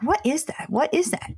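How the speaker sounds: high-pitched baffled double take; puzzled what-is-that. Retold or imitated. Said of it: "And we're like".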